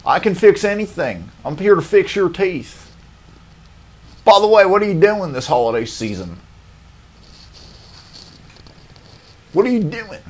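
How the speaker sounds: high, breezy, cocky dentist voice; overconfident dentist. Retold or imitated. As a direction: chatter on like a dentist too sure of himself. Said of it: "overly confident like that".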